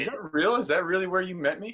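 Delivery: surprised. Real, not acted. Surprised at the story.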